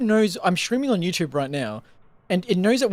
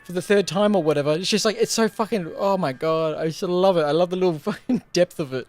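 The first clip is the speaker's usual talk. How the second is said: ordinary talk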